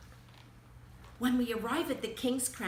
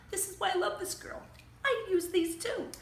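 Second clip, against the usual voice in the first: delighted; 11 semitones higher, 5 dB louder than usual. Real, not acted. Delighted reader comments.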